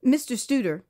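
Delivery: ordinary talk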